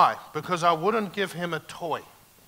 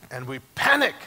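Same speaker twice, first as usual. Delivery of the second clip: ordinary talk, nothing marked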